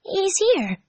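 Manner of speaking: high excited gasp; thrilled, quick hope. A narrator's reading. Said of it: "Tails whispered to himself excitedly".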